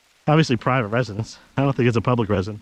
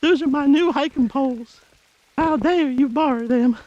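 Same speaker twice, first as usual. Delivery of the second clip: much higher, drawn-out put-on accent; Pepper, mock complaint; 14 semitones higher, slower than usual. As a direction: complain in a slow, high-pitched mock accent. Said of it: "At least not in that accent".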